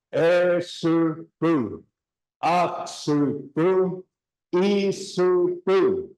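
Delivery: each word drawn out slowly; teacher modelling word by word; slow drill. Shown as a demonstration. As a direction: drill Esupu, Aksupu, Isupu slowly. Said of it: "let's just take it from the top".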